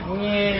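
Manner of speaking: a small, soft "Nyeh" where a roar should be; feeble bleat instead of roar. Acted. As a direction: bleat it timidly, like a shy lion who can't roar. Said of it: "But instead of roar".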